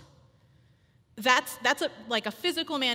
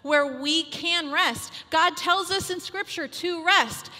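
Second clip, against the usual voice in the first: loud, high exhortation; rally the women to trust God; impassioned sermon push; fervent preaching; 4 semitones higher, 6 dB louder than usual. Acted. Preaching at full force.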